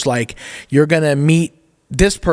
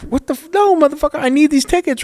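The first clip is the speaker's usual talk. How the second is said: high-pitched acted outrage; indignant protest; 13 semitones higher than usual. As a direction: retell your protest in a much higher voice. Acted protest over money and tickets.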